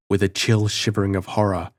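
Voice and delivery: plain reading voice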